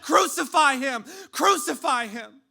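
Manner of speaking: high, full-throated mob shout; crowd calling for death. Acted. Whole crowd demands the death of Jesus, shouting. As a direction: roar the chant at full force. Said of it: "cheering and calling for the death".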